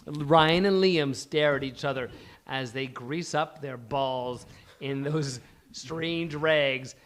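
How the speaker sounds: ordinary talk, nothing marked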